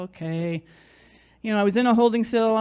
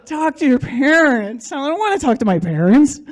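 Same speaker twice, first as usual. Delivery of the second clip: mocking exasperation; 5 semitones higher, 5 dB louder than usual. Fed up with these people's advice, mocking and protesting loudly. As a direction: throw the advice back in exasperation.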